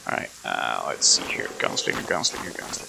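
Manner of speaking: hushed radio whisper. Real, not acted. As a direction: whisper it so the patrol can't hear. Whispering near enemy patrol.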